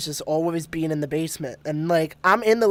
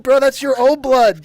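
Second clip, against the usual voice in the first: louder, higher mock disbelief; exasperated retort; 4 semitones higher, 6 dB louder than usual. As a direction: set a drunk friend straight, voice raised. Retold exasperated reply to friend.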